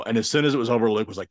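normal talking voice

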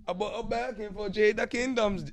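high, loud bragging rapper impression; hammed-up boastful rapper; imitating a rapper. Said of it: "He said".

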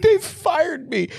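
very high, drawn-out comic wail; mock crying. Imitating a crying Angela who got fired, mock crying. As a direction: wail it slowly in a squeaky fake cry. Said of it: "Angela's crying".

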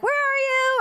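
high mock holler for someone; calling out. Wife searching the driveway, high re-enacted call. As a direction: sing it out as if across a yard.

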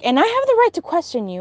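retold high, fast retort; acting out an aggressive comeback; defensive and aggressive. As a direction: assert yourself in a rush, pitch jumping up. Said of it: "defensive and aggressive".

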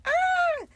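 a sudden, sharp, high squeal. Real, not acted. Celebrating her win with a high squeal. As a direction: let out a quick, piercing squeal of delight.

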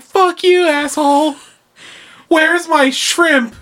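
high, loud mock yelling, slow on the shrimp; rude customer. Holiday customer cursing at the seafood clerk, loud high mock rage. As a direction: bark it like an entitled shopper. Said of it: "They're like".

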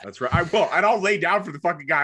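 ordinary talk, nothing marked

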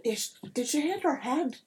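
put-on high accented voice, softening to an aside; puzzled little-folk voice; puzzled leprechaun. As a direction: confer with the other leprechauns, baffled. Leprechauns confer about stranger.

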